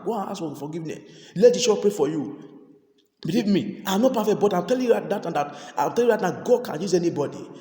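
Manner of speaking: urgent call to repent; fervent preaching. Real, not acted. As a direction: press the congregation hard. Preaching at full force.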